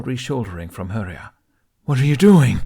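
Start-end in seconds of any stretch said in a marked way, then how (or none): 1.8-2.6 loud dragon roar of a question. Acted. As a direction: bellow it in alarm. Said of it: "Toothless roared".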